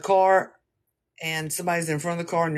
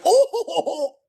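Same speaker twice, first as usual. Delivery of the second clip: high, loud startled hoot; shocked "oh"; 12 semitones higher, 5 dB louder than usual. Retold or imitated. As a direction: let out a big high "oh" as if shocked, half laughing. Retells reaction to the gunshot.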